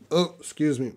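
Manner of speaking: usual speaking voice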